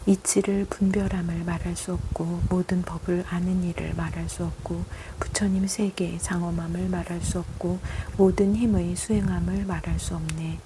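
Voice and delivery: usual speaking voice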